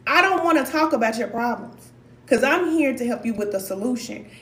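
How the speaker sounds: normal talking voice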